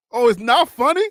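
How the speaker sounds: ordinary talk, nothing marked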